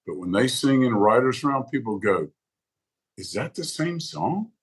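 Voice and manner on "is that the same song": softer, high, slow mock bewilderment; puzzled reaction. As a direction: imitate a doubtful listener, swinging up at the end. Acts out puzzled listeners.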